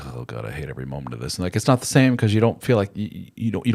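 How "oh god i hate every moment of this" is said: low, soft, fast grumble; low quick mock muttering; grumbling aside. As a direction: mutter it under your breath. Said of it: "you just sit there like".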